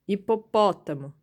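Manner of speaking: Hipopótamo with a loud PÓ; antepenultimate stress. Shown as a demonstration. Teacher showing the strong syllable, stressing the antepenultimate. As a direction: punch the PÓ in Hipopótamo. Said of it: "A antepenúltima, pó".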